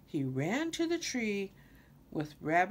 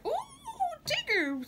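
much higher, thrilled squeal; bigger squeal; slower than usual. A narrator's reading. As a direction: squeal even higher with glee. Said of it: "squealed Roo".

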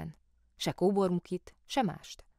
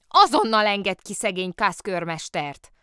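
loud, higher-pitched firm order; commanding; 4 semitones higher, 8 dB louder than usual. A narrator's reading. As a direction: order it done at once. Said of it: "parancsolta Lotta".

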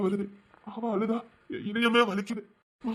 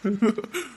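short laugh. Acted. Laughs at friend's news.

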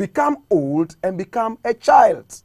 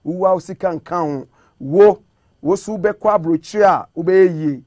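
ordinary talk, nothing marked

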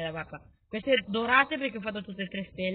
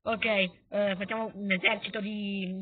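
ordinary talk

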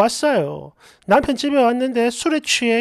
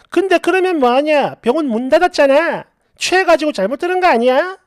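ordinary delivery

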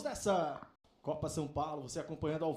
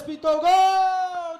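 goal shout; 4 semitones higher, 8 dB louder, much slower than usual. Real, not acted. Goal is scored.

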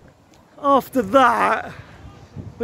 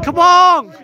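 loud, high cheer; 6 semitones higher, 7 dB louder than usual. Real, not acted. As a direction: urge the team on. Cheering on the pitch.

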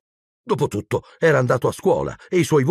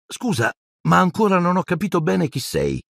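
put-on much higher-pitched boy's voice; a polite, puzzled child's question; timid boy asking; 9 semitones higher than usual. A narrator's reading. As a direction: lift the voice high for the boy.

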